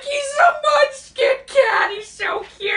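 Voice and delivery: high, loud, slow gushing with a cry in it; gushing over cat. Retold or imitated. Retelling meeting an adorable cat, high loud gushing. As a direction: squeal over the tiny cat. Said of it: "I almost cried".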